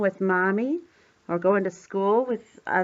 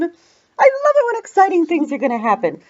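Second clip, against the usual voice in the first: excited; 8 semitones higher, 6 dB louder, faster than usual. Real, not acted. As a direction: bubble over with eager anticipation. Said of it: "that makes me excited".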